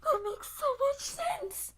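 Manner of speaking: high, slow fake sob; mock crying. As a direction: whimper it like mock despair.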